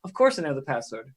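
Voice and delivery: charming bluff. Acted. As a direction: cover panic with a charming front. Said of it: "the charming face back again".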